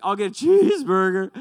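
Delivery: put-on a squeaky, too-loud "I'll get a cheeseburger" from a flustered boy; nervous cracking voice. Flustered teen blurting his order at the counter, high and loud. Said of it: "make my voice lower".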